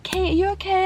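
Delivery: high, soft mock concern; lightly voiced worried housemaster. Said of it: "it's like".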